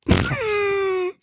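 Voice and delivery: very high shrieked bye; squealed goodbye. Pretend.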